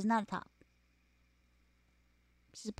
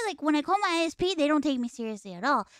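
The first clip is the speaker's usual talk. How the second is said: usual speaking voice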